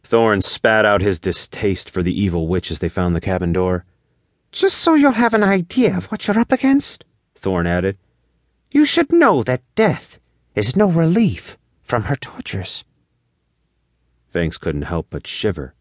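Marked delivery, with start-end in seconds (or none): 4.5-7.0 very high little elf voice; piping elf character voice; tiny elf. Acted. Elf warns about the witch. 8.7-12.8 high, grave little elf; piping elf voice, ominous; tiny elf. Acted. Elf warns about the witch.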